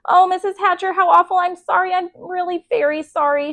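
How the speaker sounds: put-on high, pleading child's apology; a lightly voiced upset girl; tearful apology. A narrator's reading. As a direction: apologize near tears. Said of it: "Sheila cried".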